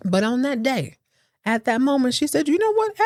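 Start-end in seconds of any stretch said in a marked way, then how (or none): 2.5-3.0 much higher, exasperated "you know what"; Cassandra snapping. Retold or imitated. Cassandra finally fed up with Richard, high exasperation. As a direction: hit it high like you're done.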